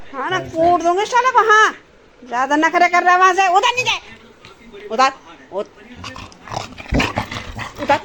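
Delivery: real angry scolding. Real, not acted. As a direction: shout him down for climbing on papa's lap. Scolding and shooing the child.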